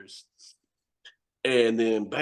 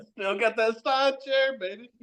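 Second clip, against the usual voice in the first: gloating put-on voice; 10 semitones higher than usual. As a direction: savor the brag.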